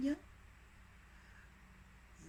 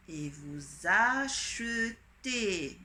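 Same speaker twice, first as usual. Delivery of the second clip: slow dictation; much slower than usual. Shown as a demonstration. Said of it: "dictation full phrase slow".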